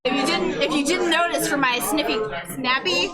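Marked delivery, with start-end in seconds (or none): none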